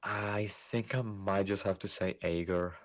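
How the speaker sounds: ordinary delivery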